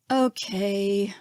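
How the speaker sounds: dejected. A narrator's reading. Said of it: "sounding completely dejected".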